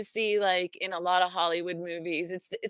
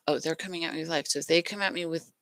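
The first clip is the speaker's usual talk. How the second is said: ordinary talk, nothing marked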